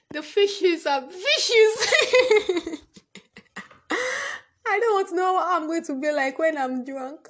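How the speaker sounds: words squeezed out through loud, high laughter; real helpless giggling fit; laughing. Real, not acted. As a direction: laugh so hard it almost turns to crying. Cracking up at own joke.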